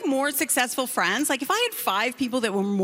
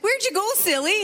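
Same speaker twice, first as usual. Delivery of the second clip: lightly teasing, high-pitched question; hint of a bubbly coach; playful coach; 9 semitones higher than usual. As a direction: tease a client in a higher voice. Life coach teasing her late client.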